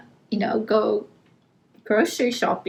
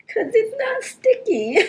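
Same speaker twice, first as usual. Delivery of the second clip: imitating yelling; 11 semitones higher than usual. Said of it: "I was yelling at him".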